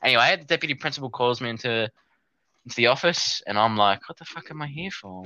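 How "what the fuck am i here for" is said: very soft, higher inner thought; small, much softer bewildered question; hushed alarm. Retold or imitated. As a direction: say it under the voice, baffled. Retelling private alarmed thought in office.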